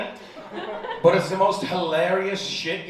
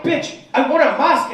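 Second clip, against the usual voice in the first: high, loud, fed-up shout; exasperated yell; 9 semitones higher, 6 dB louder than usual. Retold or imitated. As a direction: snap at her in frustration. Furious demand after being slapped.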